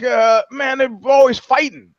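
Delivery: imitated local guy. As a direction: play the guy griping about the brothers. Voicing the Stockton local.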